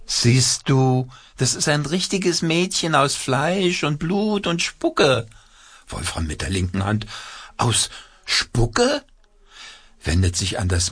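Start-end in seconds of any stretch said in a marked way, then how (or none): 0.1-5.3 high puppet voice. A narrator's reading. Hand puppet speaks. 7.6-9.0 very high astonished puppet squeak. A narrator's reading.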